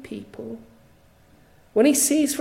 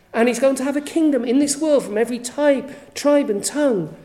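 full-force preaching; 2 semitones higher, 7 dB louder than usual. Real, not acted. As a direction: preach it out, much louder, rising to a climax. Preaching climax on triumph.

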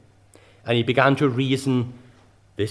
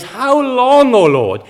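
high, loud lament; David's cry from Psalm thirteen, high and loud; 8 semitones higher, 7 dB louder, slower than usual. Retold or imitated. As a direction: wail it up to heaven, high and loud. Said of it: "David again cries".

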